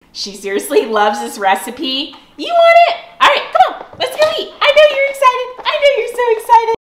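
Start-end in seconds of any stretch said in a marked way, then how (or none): 2.4-6.8 excited dog talk. Real, not acted. Owner hypes her dogs before dinner, high squeaky baby talk. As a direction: gush at the dogs like they're toddlers.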